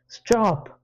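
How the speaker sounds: high, loud shriek. Acted. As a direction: scream at her to make it stop. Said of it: "I shriek".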